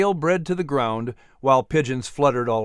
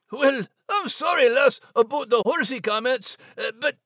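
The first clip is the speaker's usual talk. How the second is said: much higher Scots apology; Scottish Max; 9 semitones higher than usual. Acted. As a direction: shrug it off in a Scottish brogue. Max apologizes then asks.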